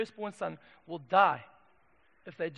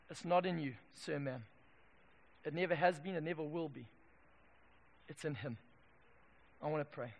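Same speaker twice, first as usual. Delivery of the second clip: soft, low closing words; solemn, hushed appeal; 5 semitones lower, 9 dB quieter than usual. Real, not acted. Preacher to congregation before praying, solemn and hushed. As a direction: bring it down gently towards prayer.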